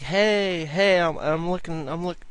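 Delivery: calling out hey across the street; retold yelling. Stranger yelling at narrator down the street, mock yell. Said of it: "He's just yelling at me".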